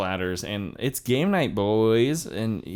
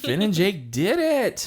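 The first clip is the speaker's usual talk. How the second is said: mock triumph; 8 semitones higher than usual. Announces the heroes' success.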